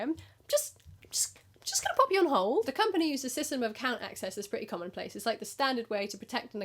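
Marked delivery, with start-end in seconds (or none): 0.0-2.7 high breathy whisper; whispered stalling. Retold or imitated. Agent sneaking the caller onto hold, whispered. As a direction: whisper it as a flustered excuse. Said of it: "Oh, sorry".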